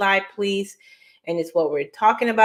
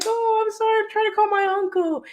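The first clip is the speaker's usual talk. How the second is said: apologetic self on phone; faster than usual. Retold or imitated. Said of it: "And I was like".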